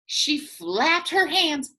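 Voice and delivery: usual speaking voice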